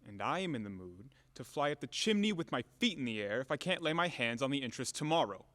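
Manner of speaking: ordinary delivery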